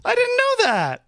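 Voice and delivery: high, squeaky astonished reaction; genuinely taken aback, voice jumps high; surprised. Real, not acted. Learning a surprising fact.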